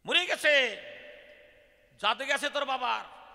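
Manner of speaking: high, loud hammed-up proclamation; shouted announcement. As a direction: shout the scandal at the children. Acting Brahmins announcing the loss. (চড়া গলায় চেঁচিয়ে ঘোষণা)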